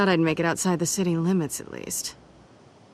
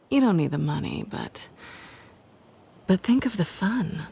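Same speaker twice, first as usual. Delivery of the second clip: normal talking voice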